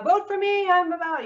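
high, pleading mock plea to Democrats. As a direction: squeak it out like a desperate candidate.

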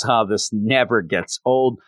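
ordinary delivery

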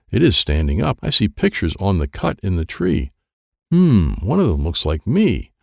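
usual speaking voice

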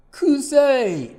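高く大きく叫ぶ; 悪臭に思わず叫ぶ. A narrator's reading. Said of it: "と叫んで". (鼻をつまんで「臭い！」と叫ぶ)

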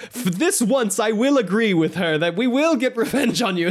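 high, prissy grudging voice; imitating Ferdinand's reluctant agreement; mock snooty Ferdinand. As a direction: grudgingly concede like a sulky noble. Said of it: "he's just like".

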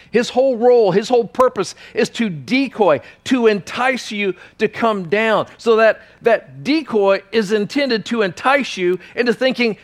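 high, loud, urgent sermon; fiery preaching. Real, not acted. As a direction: drive it home with force. Preaching climax, raised voice.

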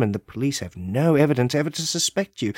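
usual speaking voice

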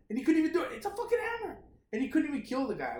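high-pitched comic disbelief; incredulous. Real, not acted. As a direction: voice shoots way up, baffled. Mock disbelief at a failed attack.

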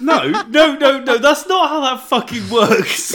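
high, loud shouted objection; outraged protest. Real, not acted. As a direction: shout down the scorekeeper's ruling. Protests the points awarded.

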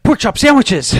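high loud goofy shout; dubbed cartoon yell. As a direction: bellow it like a dubbed hero. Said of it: "he's like".

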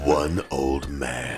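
very low, slow movie trailer announcer; deep, drawn-out action trailer narration; RoboCop trailer voice. Acted. Host counters with an action trailer, deep slow announcer voice. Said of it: "like, a RoboCop".

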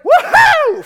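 huge high-pitched cheering whoop; very loud celebratory holler; big woohoo. Shown as a demonstration. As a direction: whoop it out to get the crowd cheering. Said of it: "give me a big, like".

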